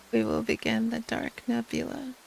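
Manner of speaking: ordinary delivery, nothing marked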